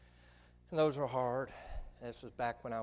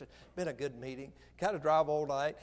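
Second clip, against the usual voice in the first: normal talking voice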